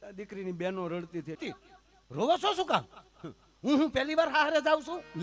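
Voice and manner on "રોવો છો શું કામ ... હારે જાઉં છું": high, loud laughing bride voice; cheeky bride. Acted. Bride laughingly scolds her crying sisters in a high voice. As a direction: tease the criers, voice way up.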